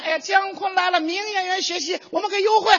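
school staff greeting. Retold or imitated. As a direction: gush over the famous student like a fawning clerk. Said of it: "人家".

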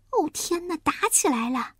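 high, softened exclamation; worried. Acted. Little pig hears fighting in the hole, worried. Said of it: "稀里呼噜担心地说".

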